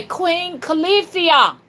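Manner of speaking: high, slow, loud call; shouting. Shown as a demonstration.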